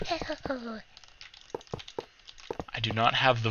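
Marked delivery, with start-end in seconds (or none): 0.1-0.8 soft, very high mouse squeal; mouse impression. Gamer mocking own mouse impression, soft squeak. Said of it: "the mouse impression".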